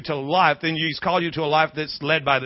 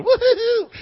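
mock whoop.

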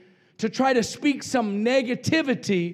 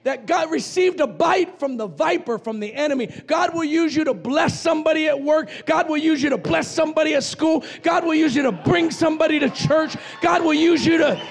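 rising, loud preacher cadence; fired-up pulpit preaching; preaching at full force; 6 semitones higher, 3 dB louder than usual. Real, not acted. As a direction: drive it home to the congregation. Preaching revival to the church.

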